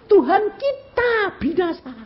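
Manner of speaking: very high, drawn-out mock wail; hammed-up plaintive cry; disciples' wail. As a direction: imitate helpless disciples, slow and high-pitched. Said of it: "Ini murid-murid lebih kurang ajar lagi".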